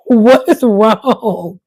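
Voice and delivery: slow, loud, drawn-out puzzled cry; mock exasperation. Retelling her failed comment to viewers, mock exasperated. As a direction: act out being stuck at the button, baffled.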